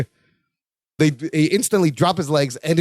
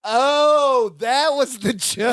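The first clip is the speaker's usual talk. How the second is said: higher, drawn-out dawning understanding; sudden realization; 4 semitones higher, much slower than usual. Real, not acted. Host finally getting the lady joke, slow high realization. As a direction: stretch it out as it clicks.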